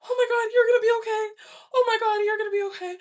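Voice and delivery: high, loud, crying pep talk to herself; panicked self-talk. Retold or imitated. Driver panicking as her car catches air, crying loud and high. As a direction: sob out reassurance while the car skids. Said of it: "I'm breathing my way through this".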